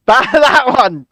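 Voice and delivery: normal talking voice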